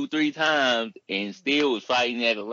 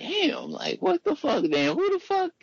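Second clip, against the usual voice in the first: high, shocked falsetto disbelief; retelling his own baffled reaction to the show, in high astonishment; stunned disbelief; slower than usual. Retold or imitated. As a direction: squeal it like you can't believe what you just saw. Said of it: "it had me questioning".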